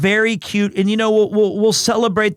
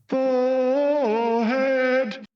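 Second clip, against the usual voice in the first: imitating baby voice; 5 semitones higher, 4 dB quieter, much slower than usual. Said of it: "He goes".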